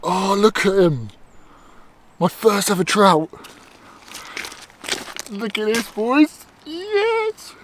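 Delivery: high, delighted admiring; proud joyful showing off; excited. Real, not acted. Showing off his first trout.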